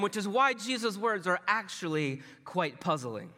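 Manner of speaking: ordinary talk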